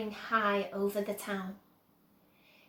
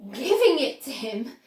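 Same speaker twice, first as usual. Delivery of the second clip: louder astonished question; gasp; 3 semitones higher, 9 dB louder than usual. A narrator's reading. Grandpa Joe astonished at Wonka's gift, gasping. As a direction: gasp it in disbelief. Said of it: "gasped Grandpa Joe".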